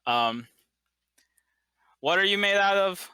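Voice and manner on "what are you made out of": higher, even-toned mysterious machine; sonorous voice. Acted. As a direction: intone it like a resonant construct. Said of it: "you hear the sonorous voice go".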